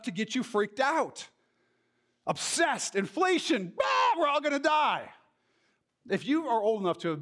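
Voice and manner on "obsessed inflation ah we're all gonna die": mock panic. Mocking people freaking out.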